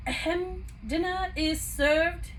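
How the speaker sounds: slow, lofty announcing voice; proud announcement. A narrator's reading. Cogsworth announcing dinner to Belle, proud and slow. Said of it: "he announced proudly".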